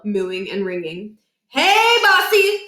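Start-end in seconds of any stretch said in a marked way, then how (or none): 1.5-2.6 high, loud, slow drawn-out call; stretched-out singsong cow call. A narrator's reading. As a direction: coax the lead cow closer. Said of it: "said Niles".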